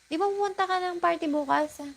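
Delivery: high, fast, bright-sounding Tagalog question; lively question. Shown as a demonstration. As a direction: fire off the Tagalog tag question playfully. Tagalog version of the example.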